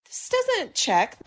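doubtful nurse. Retold or imitated. Voicing a nurse.

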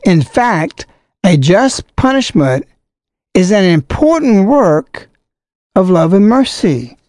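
formal letter reading. Acted. Reads bishop's letter aloud.

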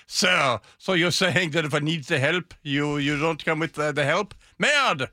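lightly put-on French accent, rising; a hint of a huffy Frenchman. Comedian voicing a French leader, hint of French accent. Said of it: "make it sound like a French person said it".

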